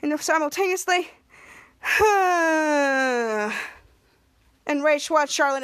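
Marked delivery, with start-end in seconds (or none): 1.8-3.7 long, drawn-out sigh; imitated group sigh. All of them sighing simultaneously, long acted sigh. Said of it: "And they sighed".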